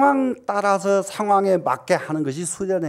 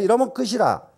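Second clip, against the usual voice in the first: ordinary talk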